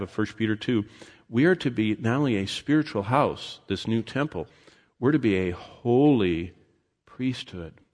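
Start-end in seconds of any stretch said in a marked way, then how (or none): 5.4-7.8 "holy" drawn out, then a pause before "priesthood"; stress on HOLY. Real, not acted. Preacher reading out the verse, stress on HOLY. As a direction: lean slowly on the word "holy". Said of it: "Emphasis on holy".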